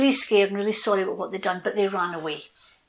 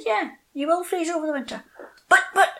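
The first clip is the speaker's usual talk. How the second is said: north wind reply; 9 semitones higher than usual. Acted. North wind answering oak.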